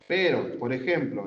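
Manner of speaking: ordinary talk, nothing marked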